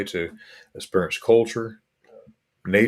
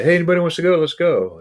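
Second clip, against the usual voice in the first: mock-cheerful open call, pitched higher and louder; eager invitation; 9 semitones higher, 5 dB louder, faster than usual. Host recalling how he used to invite all travellers, eager. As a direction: call everyone along like an eager tour host. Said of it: "I used to say".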